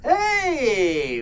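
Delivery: ordinary delivery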